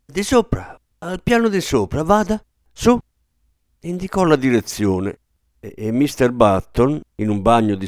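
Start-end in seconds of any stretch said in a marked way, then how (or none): 0.0-3.0 much higher-pitched frantic directions; hysterical. A narrator's reading. Nurse sending the father upstairs, hysterical. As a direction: send him away in a panic. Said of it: "esclamò isterica".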